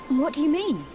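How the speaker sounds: very high, loud outburst; startled demand. Acted. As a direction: demand an explanation in shock. Reacting to shocking news.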